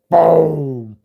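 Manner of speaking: loud, booming blast noise; imitated explosion. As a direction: burst out a big boom.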